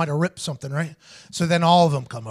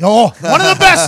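proud boast; 5 semitones higher, 10 dB louder than usual. Real, not acted. Boasting about holding grudges.

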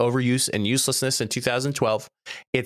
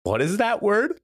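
high-pitched imitation of a baffled mom; mock-baffled, rising question; puzzled mom; 9 semitones higher than usual. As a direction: quote mom questioning a strange word, pitch climbing. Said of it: "my mom looked at me".

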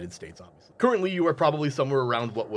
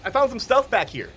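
high, louder Frank voice; character voice; 9 semitones higher, 4 dB louder than usual. Acted. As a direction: voice a cheery crew member. GM continues Frank voice.

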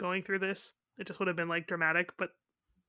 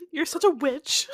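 higher, louder teasing insult; mock scolding; 8 semitones higher, 9 dB louder, slower than usual. Imagining Mara scolding Joy, loud teasing insult. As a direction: fling the insult playfully.

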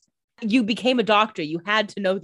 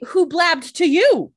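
indignant; 9 semitones higher, 7 dB louder, slower than usual. Real, not acted. Demanding who leaked secret.

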